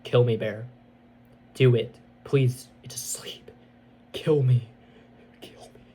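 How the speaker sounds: pleading that sinks to a whisper; beg, then fade to breath; eerie pleading that dies into a whisper; fading to whisper. Retold or imitated. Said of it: "saying the persona".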